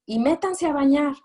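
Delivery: high, loud order to bathe; parent's order. Retold or imitated. Lecturer mimicking a parent yelling at the kids, high and loud. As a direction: bark it like a fed-up parent.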